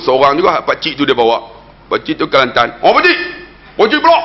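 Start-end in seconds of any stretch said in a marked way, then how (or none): 2.8-4.2 high-pitched call to the uncle; acted high holler. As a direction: urge him to take his turn, pitched way up. Calling on uncle to lead.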